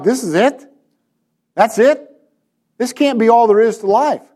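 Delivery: retold disbelief, questioning, pitch lifted; is this really all?; let-down disbelief. Retelling own letdown on arrival.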